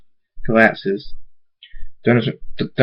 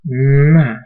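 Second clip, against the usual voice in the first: loud smacking "mwah"; exaggerated, higher kiss sound; kiss noise; 4 semitones higher, 5 dB louder than usual. End of the kiss with Thunderstorm, loud mwah. Acted kiss between two characters.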